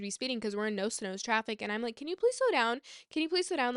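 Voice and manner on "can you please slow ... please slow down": higher, pleading retelling; raised-pitch imploring quote; urgent plea. Retold or imitated. Pleading with speeding boyfriend.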